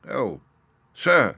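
high, loud outburst of eagerness; excited cry. A narrator's reading. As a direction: cry out as the eager little fellow. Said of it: "cried the little fellow".

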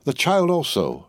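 slow, savouring the words; meditative enjoyment. Acted. Jacques three musing to Madame, slow meditative enjoyment. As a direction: linger as if tasting each word. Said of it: "with a meditative enjoyment of his words".

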